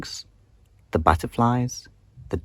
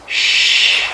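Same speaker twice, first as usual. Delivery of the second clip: loud hissed shh; a sharp, loud shh; loud shush; 6 dB louder than usual. Acted. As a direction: shush the frog hard. Daisy shushes the frog.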